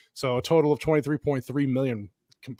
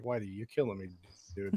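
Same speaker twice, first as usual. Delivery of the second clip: much softer muttered aside; quiet mutter; 7 semitones lower, 13 dB quieter than usual. Retold or imitated. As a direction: mutter it under your breath. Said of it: "I'm like".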